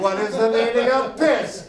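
gleeful taunting wordplay; smug pun reply. Retold or imitated. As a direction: throw his question back with a cheeky twist. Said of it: "And I'm like".

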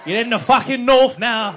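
put-on loud southern English accent. Comic telling a visitor they're up north, southern English accent. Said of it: "why I did that in a southern accent".

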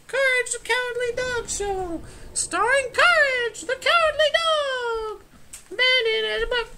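very high, loud show announcer; falsetto announcer. Pretend.

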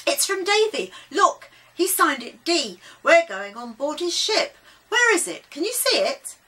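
high, loud, eager burst; excited discovery. A narrator's reading. Child hero excited at the clue.

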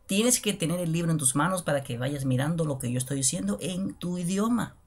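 usual speaking voice

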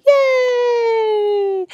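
high cheerful shout; cheering yay. Acted. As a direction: cheer like the crowd goes wild. Cheer like a crowd.

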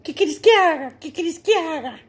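high crowing rooster. Acted. Storyteller as the rooster answering the cat, high crowing. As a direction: crow the question like a rooster. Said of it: "el, el gallo".

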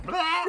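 very high, loud squeaky blah; bat death squeak. Pretend. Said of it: "That's what I said".

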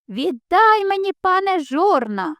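rooster yells up at the lord; shouting rooster. A narrator's reading. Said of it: "кричить".

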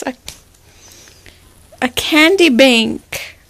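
ordinary talk, nothing marked

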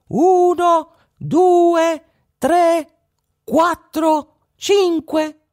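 tiny high voice echoing the count, loud; mocking echo. A narrator's reading. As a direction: mimic the robber's counting from the bush. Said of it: "gli faceva il verso". (fa il verso con vocina acuta)

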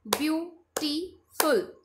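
Beautiful in three slow, separated beats; three-beat syllables. Shown as a demonstration. Teacher clapping out syllables, beautiful split in three. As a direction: pause after each syllable of beautiful. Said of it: "three times clap करेंगे".

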